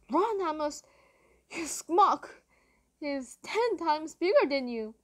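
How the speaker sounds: hurried shouted warning; urgent shout. Retold or imitated. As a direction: yell at him to run. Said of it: "I was like".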